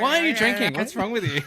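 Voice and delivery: high, loud mock outrage; laughing mock disbelief; mock incredulous. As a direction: tease him like a shocked mate. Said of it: "I'm like".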